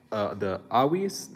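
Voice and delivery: ordinary talk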